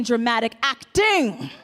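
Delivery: "Acting" stretched with a big swoop on the end; hammed-up stress on "Acting"; emphasis on ACTING. Shown as a demonstration. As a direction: hit the last syllable of "Acting" theatrically. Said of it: "gotta put the emphasis on the ting".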